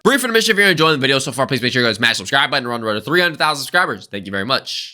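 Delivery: fast, low-falling intermission read; subscribe plug. Real, not acted. Host asks viewers to subscribe mid-video, hurried plug. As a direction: rattle it off quickly, pitch sinking toward the end.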